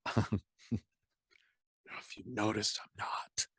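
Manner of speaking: breathy conspiratorial whisper, slowed right down; sly stage whisper; whispered aside. Retold or imitated. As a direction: lean in and whisper it like a secret. Whispered aside about not being British.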